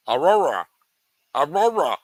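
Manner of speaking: "Aurora" pushed out loud with a falling end; trying out pronunciation. Shown as a demonstration. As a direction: say "Aurora" loudly, testing the r sounds. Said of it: "I could say her name like that".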